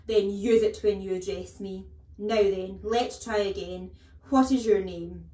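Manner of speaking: very loud, stern correction; domineering teacher's loud demand; scolding headmistress. A narrator's reading. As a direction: drill the pupil at full voice.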